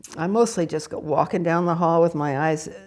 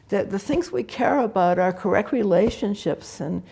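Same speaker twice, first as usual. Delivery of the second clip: usual speaking voice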